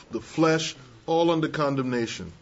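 ordinary talk, nothing marked

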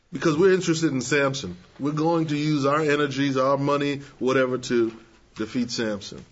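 usual speaking voice

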